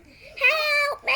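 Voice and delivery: high, loud crying plea; shouting for help. Acted. Drowning townsperson crying out, high loud plea. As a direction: scream for rescue. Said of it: "this one was shouting".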